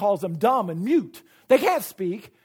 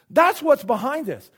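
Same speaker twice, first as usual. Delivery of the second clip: usual speaking voice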